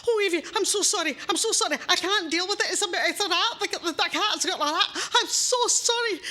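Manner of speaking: high, loud frantic apologising; husband fleeing the rat into the bedroom; panicked apology. Acted. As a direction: babble it out in a panic. Husband flees rat to bed.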